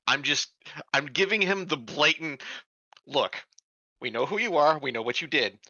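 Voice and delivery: usual speaking voice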